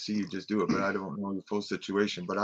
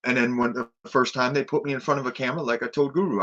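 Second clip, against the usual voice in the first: ordinary delivery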